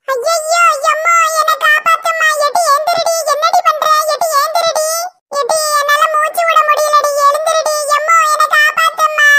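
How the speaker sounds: high, frantic cries for help; shrill panic calling for help; panicked wail. Acted. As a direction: wail for mother as you're being crushed. Panicked cries, crushed under someone.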